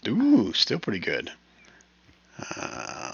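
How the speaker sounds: usual speaking voice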